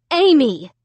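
loud sharp call; crying out. A narrator's reading. Mom crying out Amy's name, loud. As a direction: cry her name out. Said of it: "Mom cried".

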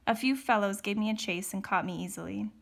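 ordinary delivery, nothing marked

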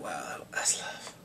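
slow, hushed whisper of awe; awed whisper. Retold or imitated. Reacting to the sacrifice of love, an awed slow whisper. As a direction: whisper it slowly, moved.

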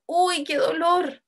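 high, drawn-out mock cry of pain; exclamación de dolor. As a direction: exclaim slowly, acting hurt. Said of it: "o está exclamando algo". (quejido de dolor actuado)

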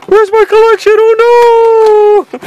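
high, loud whining wail over his lost games; mock AJ wailing. Imitating AJ wailing that his collection is gone. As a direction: wail like a kid robbed of his toys. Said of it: "and he's gonna be".

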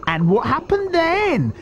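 high, slow little-croc voice; high-pitched, measured taunt; scornful small crocodile. A narrator's reading. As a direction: rub it in, high and drawn-out. Smaller crocodile mocks the boast.

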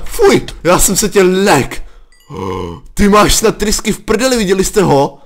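loud, high shaken rant; shaken exclamations. Real, not acted. Gamer telling viewers about the scare, loud shaken voice. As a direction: still rattled, tell the viewers. Recovering from fright.

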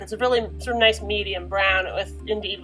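ordinary delivery

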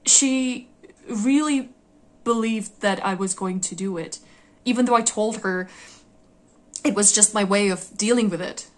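loud, exasperated protest, pitch jumping up on the first words; worked-up, emphatic complaint; indignant disbelief. Acted. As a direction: insist on it, loud and incredulous.

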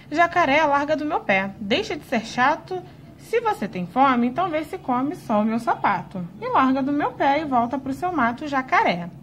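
put-on loud, lively children's rhyme; reciting a rhyme. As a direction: recite it to the class with gusto. Reads a rhyme aloud.

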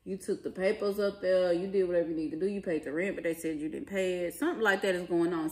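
usual speaking voice

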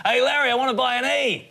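high, loud heckler-style yell; mock yell from a distant loudmouth. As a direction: holler it across the airport like an idiot Aussie. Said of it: "idiot Aussie ... yells out".

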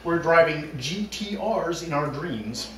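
normal talking voice

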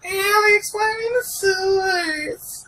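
very high, drawn-out calling voice; needy companion wail. Acted. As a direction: wail after Alex in falsetto.

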